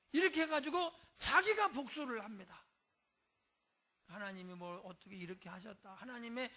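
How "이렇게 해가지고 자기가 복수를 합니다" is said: ordinary delivery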